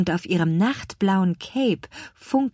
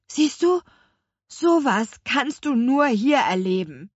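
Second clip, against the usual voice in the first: excited; 7 semitones higher than usual. A narrator's reading. Thrilled girl shows brother.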